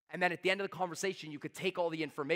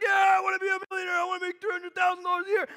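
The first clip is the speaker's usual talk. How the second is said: much higher, loud eager cheering; mock eager shout; 13 semitones higher, 7 dB louder than usual. Coach mocking the audience's eager cheer, loud and high. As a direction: yell it like a hyped crowd. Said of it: "Everyone's like".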